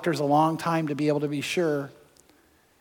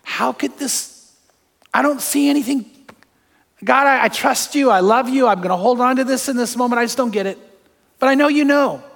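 rising, louder anguished plea; pleading prayer; 6 semitones higher, 3 dB louder than usual. Retold or imitated. Son retelling his desperate prayer to God, rising anguished plea. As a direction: cry out to God from the pain. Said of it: "I remember saying to God".

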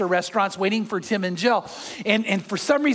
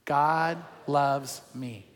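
slow, hushed word-by-word recitation; soft and drawn out, leaving space after each word; leading group recitation; 7 semitones lower, 5 dB quieter, much slower than usual. Shown as a demonstration. Pastor leads congregation saying it on three, slow group recitation. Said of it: "Let's say".

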